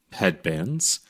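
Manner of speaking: usual speaking voice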